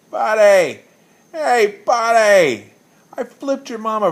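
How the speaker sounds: put-on loud, high, slow drunken holler with a laugh; drunken laughing yell. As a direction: bellow it like a laughing barfly. Drunk yelling across bar.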